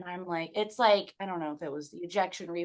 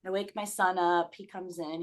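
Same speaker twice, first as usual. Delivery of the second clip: normal talking voice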